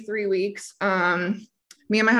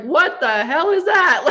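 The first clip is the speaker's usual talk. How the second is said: high, louder, slow startled cry with a laugh; shocked laugh; 8 semitones higher, 6 dB louder, slower than usual. Retold or imitated. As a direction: blurt it out as the needle goes in. Needle stuck in, shocked.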